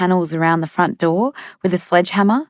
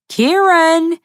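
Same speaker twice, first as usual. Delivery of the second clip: higher, loud, long stretched call of Kieran; drawn-out yowl; 12 semitones higher, 4 dB louder than usual. A narrator's reading. Mick keeps howling for Kieran inside, drawn-out high yowl. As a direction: let the name howl out long and high. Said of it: "in drawn-out yowling sounds".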